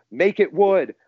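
high, punchy mock yell; retold screaming. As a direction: imitate a crowd's scream, partly held back. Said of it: "turns into us screaming".

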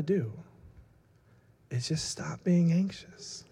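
hushed mock-guru calm, long pauses, sinking to a whisper; ultra-chill advice. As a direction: soothe with exaggerated calm, ending whispered. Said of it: "the most chill person I know".

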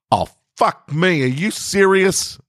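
high, slow, disbelieving outburst; shocked exclamation. Acted. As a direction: gape at the fridge in disbelief. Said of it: "I exclaimed".

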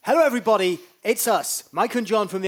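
loud, high host introduction; showy introduction. Acted. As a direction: announce the act to everyone. Another go at a big introduction.

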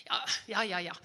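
normal talking voice

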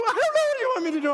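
very high, rapid mock wail; hysterical girl. Young girl to dad, mock hysterical wail. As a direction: squeal it in hammed-up helpless tears. Acted crying daughter answers.

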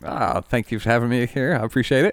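usual speaking voice

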